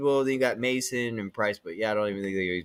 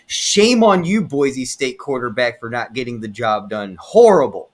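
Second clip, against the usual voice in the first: angry rant; 8 dB louder than usual. Real, not acted. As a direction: blast him for blowing the game. Ranting at the quarterback.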